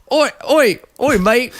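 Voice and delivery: high-pitched bad British, Australian-sounding; badly faked Cockney-style British call; attempted British accent. Shown as a demonstration. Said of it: "I'll try to do British".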